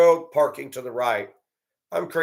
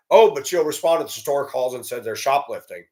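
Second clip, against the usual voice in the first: ordinary delivery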